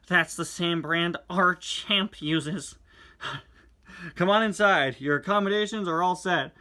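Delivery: animated reading of the twins and Sally; comic dialogue read-aloud. Acted. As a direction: perform the comic lines with energy.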